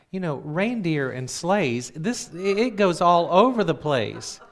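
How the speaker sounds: high, loud, agitated fretting; imitating worried brother. Brother frets to sister about sleigh rides, loudly agitated. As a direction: get worked up, pitch and volume climbing.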